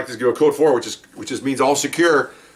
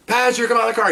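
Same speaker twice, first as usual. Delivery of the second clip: high shouted police order; yelling order; 11 semitones higher, faster than usual. Retold or imitated. Officer yelling at the passenger to get out. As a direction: call the passenger out sharply. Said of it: "now I start yelling".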